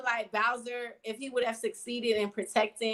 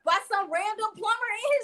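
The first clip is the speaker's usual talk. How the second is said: indignant; 7 semitones higher, 6 dB louder than usual. Real, not acted. Outraged defense of Bowser.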